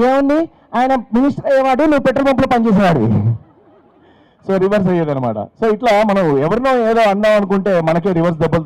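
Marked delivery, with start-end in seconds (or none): 0.1-3.3 smug retort. Retold or imitated. Wife's punchline retort.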